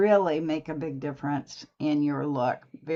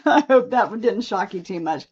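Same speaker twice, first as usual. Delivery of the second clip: laughing; 3 semitones higher, 7 dB louder than usual. Real, not acted. Laughing after a joke.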